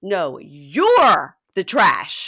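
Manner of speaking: loud, very drawn-out comeback; acted retort. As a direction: lean long and hard on YOU'RE, mimicking him. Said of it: "he goes".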